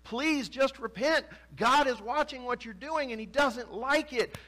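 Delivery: high, pleading prophet's plea; Jeremiah pleading. Retold or imitated. Prophet begging his people to repent, high pleading. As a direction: beg them to repent, voice straining high. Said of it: "he continues to preach".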